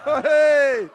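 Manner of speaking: high, laughing shout; laughing whoop. Real, not acted. Host reacting to the fighter on the scale, laughing whoop.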